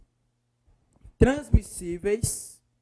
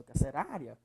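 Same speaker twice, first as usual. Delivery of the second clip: "Carcerária" lower, with weight on RÁ; stress on RÁ; 5 semitones lower, 7 dB quieter than usual. Shown as a demonstration. Teacher answering which syllable is strongest, stressed RÁ. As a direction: lean on the third syllable, RÁ, in "Carcerária". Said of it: "É orá".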